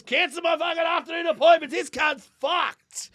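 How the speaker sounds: high shout, drawn out at the end; mock alarmed doctor. Doctor cancelling afternoon appointments, mock alarmed shout. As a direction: shout orders as a panicked doctor, hammed up. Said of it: "He goes".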